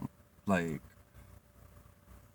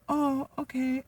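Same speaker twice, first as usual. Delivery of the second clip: much higher squeaky boss voice, held; mimicking boss Jenny; slower than usual. Imitating the restaurant boss asking her to work Sunday. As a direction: pitch way up to play the boss.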